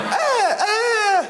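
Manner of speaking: high screaming goat yell. Retold or imitated. Comedian imitating the viral goat, high bleating scream. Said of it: "nailed that fucking screaming".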